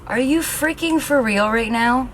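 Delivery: high, loud, slow exasperated demand; frustrated outburst. Real, not acted. To the curling iron that switched off, frustrated outburst. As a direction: hurl it at the machine, fed up. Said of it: "I'm getting so frustrated right now".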